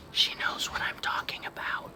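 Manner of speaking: whispered aside; quiet teasing whisper. Real, not acted. Teacher whispers to class.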